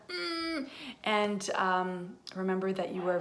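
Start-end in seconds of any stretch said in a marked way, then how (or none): 0.1-0.7 high, soft squeezing "mmm"; pretend hug squeeze. Reader sends Lauren a birthday hug, squeezing hum. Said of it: "give you a big birthday hug".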